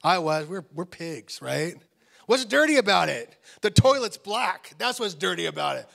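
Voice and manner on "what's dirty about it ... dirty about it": loud, high mock argument; exasperated bickering. Father and teenage son bicker over filthy bathroom, loud exasperation. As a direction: raise voice and pitch in disbelief.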